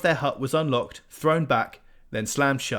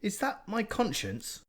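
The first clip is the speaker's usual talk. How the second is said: lightly voiced cat, higher and a bit slower; anxious cat Morris; 10 semitones higher, 3 dB quieter than usual. A narrator's reading. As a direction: wonder aloud, a lightly voiced worried cat. Morris wondering inside his head.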